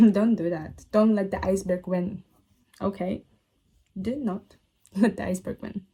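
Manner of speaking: ordinary delivery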